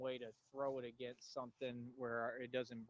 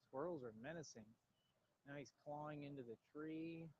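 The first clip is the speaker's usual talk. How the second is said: ordinary talk, nothing marked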